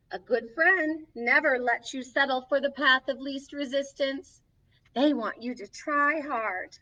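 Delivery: gentle picture-book reading; reading to children. Acted. Storybook host reading a friendship book to kids, gently. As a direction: read each page to the little ones.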